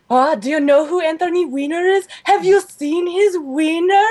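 high-pitched eager interrogation; nosy grandma impression. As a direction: quiz them like a scandalized grandmother. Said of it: "she starts in on Zoe".

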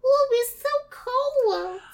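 high, loud, drawn-out wail. Acted. Imagined girl in a shawl complaining of cold, hammed-up high wail. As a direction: stretch it out slowly, high and loud. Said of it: "and being like".

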